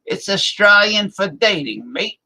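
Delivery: hammed-up Australian accent slogan like a beer commercial. Streamer riffs on the Foster's beer ad in a hammed-up Australian accent. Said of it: "the old Foster's beer commercial".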